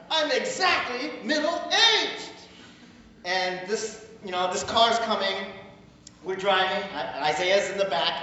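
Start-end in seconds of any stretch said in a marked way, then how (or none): 0.1-2.3 high, slow singsong wail; comic lament. Retold or imitated. Man dwelling on being middle-aged, high comic lament. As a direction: draw it out high, hamming up the dismay.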